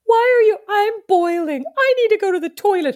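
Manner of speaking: put-on high, whiny child voice; whiny kids. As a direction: whine like a hot tired kid.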